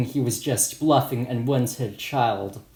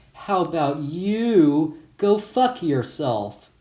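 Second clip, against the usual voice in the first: disturbingly calm; 4 semitones higher, slower than usual. Retold or imitated. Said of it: "I said in a disturbingly calm voice".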